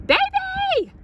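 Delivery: very high, loud call; shouting. A narrator's reading. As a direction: call her sister across the house. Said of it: "shouted Eugenia".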